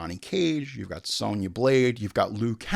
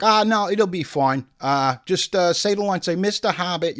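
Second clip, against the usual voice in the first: higher, louder soothing director voice; Peter Jackson impression; 6 semitones higher, 6 dB louder than usual. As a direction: coax the nervous actor like a director. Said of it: "He's like".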